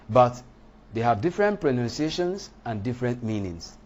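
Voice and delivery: usual speaking voice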